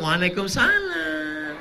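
soft, languid answer. Shown as a demonstration. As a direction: coo it sweetly down the phone. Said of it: "suara yang lemah lembut". (jawaban lemah gemulai di telepon)

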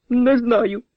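high-pitched, meek answer; cowed whimper. Acted. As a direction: squeak it out like someone beaten. Beaten Daragan answers his attacker.

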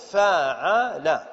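فاعل drawn out very slowly as fa-'a-la; syllable-by-syllable demonstration. Shown as a demonstration. Teacher pronouncing the pattern's vowels, فاعل slowly as fa-'a-la. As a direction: sound out فاعل as fa... 'a... la, each short vowel held. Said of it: "ثم ننطق الكلمة الفاء والعين واللام بهذه الحركات".

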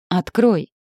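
urgent cry, kept in check; exclaimed demand. A narrator's reading. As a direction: call out a quick order. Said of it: "Тут же воскликнула".

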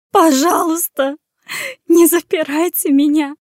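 put-on sobbing plea for freedom. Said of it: "Сквозь рыдания выдавливала она слова".

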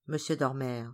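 plain reading voice

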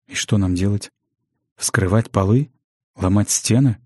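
mildly lost, tentative questions; bewildered question. A narrator's reading. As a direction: ask at a loss what to do next. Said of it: "растерянно спросил Хесс". (слегка растерянно)

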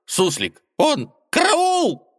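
high squeaky panic, rising; panicked squeal. A narrator's reading. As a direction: squeal for help in terror. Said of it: "Зверёк пришёл в ужас".

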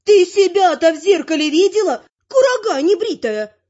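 high, loud granny outrage; indignant granny. A narrator's reading. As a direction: fire back as an affronted grandmother. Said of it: "возмутилась бабуля".

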